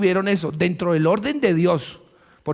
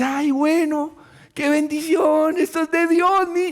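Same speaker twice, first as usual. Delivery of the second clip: mock thrilled buyer; 10 semitones higher than usual. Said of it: "usted dice".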